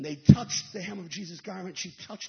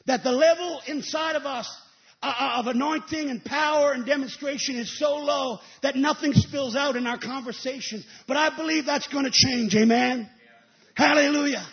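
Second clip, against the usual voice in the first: rising, louder preacher's call; preaching; 3 semitones higher, 6 dB louder than usual. Acted. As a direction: build the fire and drive it home to the church. Preacher building to a climax.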